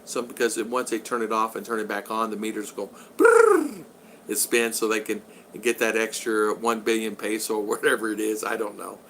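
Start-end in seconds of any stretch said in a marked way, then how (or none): 3.2-3.8 rolled brrrr spinning sound; meter whirring noise. Retold or imitated.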